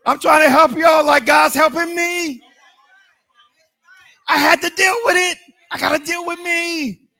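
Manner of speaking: high, loud preaching shout; full-force preaching. Real, not acted. Preacher urging his congregation to deal with themselves, shouting high. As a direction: drive it home to the church.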